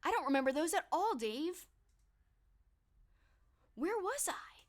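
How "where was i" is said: whisper. Real, not acted. Muttering to herself, losing place.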